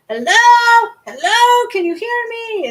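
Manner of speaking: very high, loud cawing crow voice calling out. Acted. Crow calling to the healer, high and loud. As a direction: squawk it like a crow trying to be heard. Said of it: "this crow is like".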